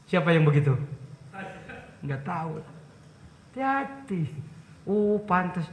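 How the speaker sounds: ordinary talk, nothing marked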